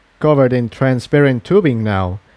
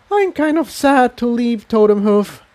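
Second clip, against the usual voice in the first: a gentle touch of regret; wistful; 11 semitones higher than usual. Acted. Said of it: "Tiptoe said wistfully".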